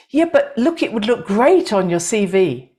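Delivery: high-pitched coaxing opener, dropping away; persuading left brain. Acted. Left brain arguing with the heart, lightly acted coaxing. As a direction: play the inner voice that talks you into it. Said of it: "your left brain comes up with all the reasons".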